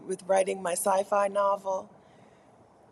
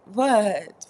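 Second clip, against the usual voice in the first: normal talking voice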